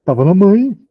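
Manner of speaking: a mild nervous excuse; boyfriend's answer. Shown as a demonstration. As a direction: blurt the alibi like a caught boyfriend. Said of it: "Ele responder assim".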